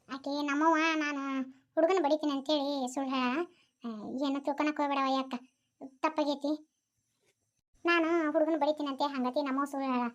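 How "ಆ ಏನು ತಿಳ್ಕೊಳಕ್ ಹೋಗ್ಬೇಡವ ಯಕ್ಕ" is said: ordinary talk, nothing marked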